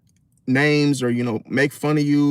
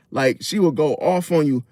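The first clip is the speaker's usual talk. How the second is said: usual speaking voice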